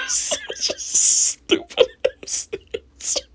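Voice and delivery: high, slow words through laughter; laughing hard. Real, not acted. Laughing at the man's answer on the show.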